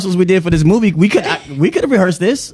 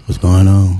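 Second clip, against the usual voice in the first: imitating deep podcast voice; 9 semitones lower than usual. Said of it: "I can imi".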